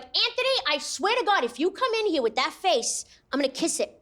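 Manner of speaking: put-on high, mad-at-Anthony Staten Island accent; Italian-American Staten Island accent. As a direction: imitate a scolding Italian-American New Yorker. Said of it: "everyone there just sounds like".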